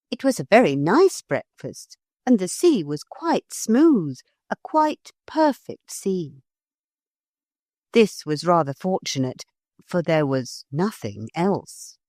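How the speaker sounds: usual speaking voice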